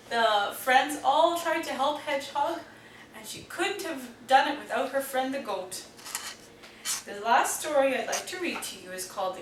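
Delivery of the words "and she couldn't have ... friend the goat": ordinary delivery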